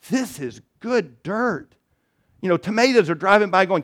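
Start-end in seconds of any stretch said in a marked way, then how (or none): 0.1-1.7 slow, hushed, savoring "This is good dirt."; a lightly voiced neighbor in bliss; dreamy dirt lover.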